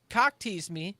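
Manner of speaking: usual speaking voice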